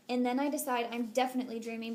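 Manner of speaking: plain reading voice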